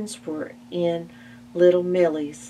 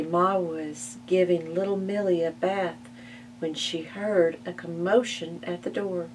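ordinary delivery

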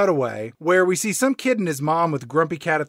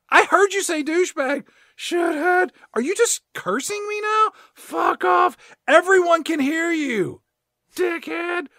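high-pitched back-and-forth mock quarrel; squabbling pair; 13 semitones higher, 4 dB louder than usual. Owner and mall rep trade insults, high mock quarrel. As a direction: alternate sly insults and shocked replies, pitched way up. Acted insult exchange, both parts.

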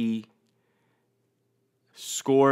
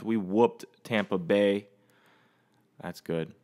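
ordinary delivery

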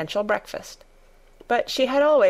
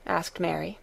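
ordinary delivery

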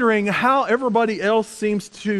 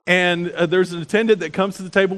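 ordinary talk, nothing marked

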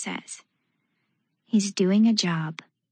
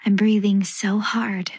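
ordinary delivery

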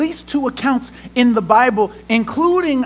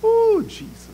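a high, soft moan of feeling; high, soft sympathetic ooh; high sighing ooh; 12 semitones higher, 4 dB quieter than usual. Real, not acted. Preacher's high exclaim.

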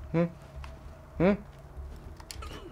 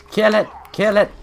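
higher, louder urgent yell; urgent shout; 8 semitones higher, 8 dB louder than usual. Real, not acted. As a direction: yell at the monster to die. Urgent cry during combat.

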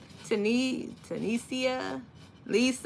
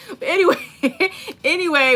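laughing; 6 semitones higher, 6 dB louder than usual. Real, not acted. Laughs at herself.

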